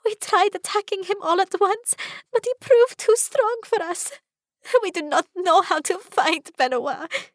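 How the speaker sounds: high, tearful voice; tearful pleading. Acted. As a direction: plead through tears. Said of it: "Estella whimpers".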